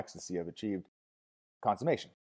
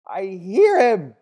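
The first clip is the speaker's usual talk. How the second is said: very loud, high, drawn-out cry; ecstatic cry; 11 semitones higher, 16 dB louder, much slower than usual. A narrator's reading. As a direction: cry it out at full voice. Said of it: "she cried".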